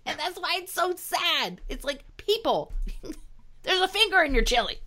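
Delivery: squeaky exasperated plea; high-pitched pleading voice. Acted. Pleading with Trump supporters.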